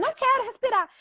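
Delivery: a child's cranky no, lightly played; whiny daughter refusing. Daughter refuses mother's breathing exercise, whiny protest.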